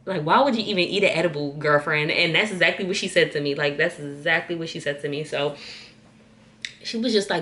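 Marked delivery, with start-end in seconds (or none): 0.0-2.1 exasperated mom impression, louder and stretched; imitated scolding mom. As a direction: scold her like a mother who can't believe it. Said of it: "she was looking at me like".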